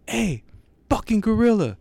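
high, loud mock holler; retold shout to get attention; calling out. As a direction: holler up at an animal on a cliff. Man calls out to the gorilla.

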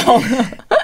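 high, loud rising laugh. Real, not acted. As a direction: laugh in disbelief. Listener laughs after her exclamation. (高声笑)

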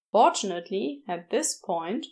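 ordinary delivery, nothing marked

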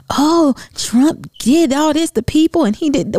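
slow, high mock of their complaint; mocking critics. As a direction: parody the outrage, drawn out. Mocks critics of her Trump photo.

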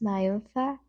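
ordinary talk, nothing marked

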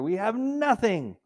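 hammed-up spoiled whine; mock whine. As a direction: drag out the complaint. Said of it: "Open up the refrigerator".

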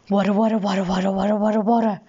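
rolling, repetitive babble; even, unbroken burbling; animal noise. A narrator's reading. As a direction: chant it as a strange creature's noise. Imitates strange animal's noise.

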